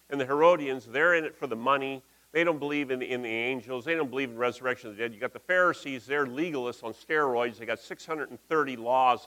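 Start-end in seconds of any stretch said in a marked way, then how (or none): none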